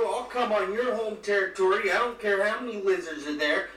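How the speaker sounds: ordinary delivery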